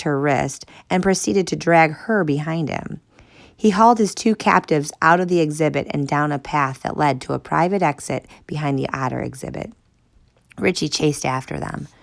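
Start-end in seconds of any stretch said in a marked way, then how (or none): none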